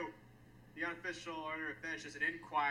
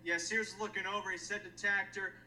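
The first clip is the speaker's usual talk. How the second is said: ordinary talk, nothing marked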